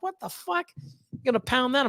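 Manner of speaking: high, stunned mock disbelief. Reacting to his buddy's pizza plan, high mock disbelief. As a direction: squeak out shocked disbelief. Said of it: "And I'm like".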